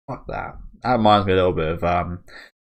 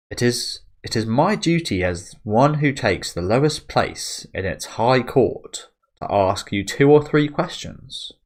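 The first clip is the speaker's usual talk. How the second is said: slow, slightly higher ceremonious reading; formal noblewoman; 4 semitones higher, slower than usual. Acted. Farnese formally addressing her prisoner, slow and ceremonious. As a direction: savour the pompous words.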